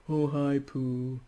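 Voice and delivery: mopey Eeyore. Pretend. A lightly voiced Eeyore greeting Pooh, mopey. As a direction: drag out the greeting, gloomy. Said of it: "It's like being in an Eeyore".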